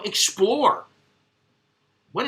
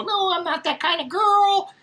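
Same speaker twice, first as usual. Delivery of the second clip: mock coy girl; 12 semitones higher than usual. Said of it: "the first night she said".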